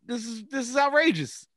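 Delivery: high, hammed-up indignation; mock outrage. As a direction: protest like a scandalized neighbor. Acting the outraged neighbor.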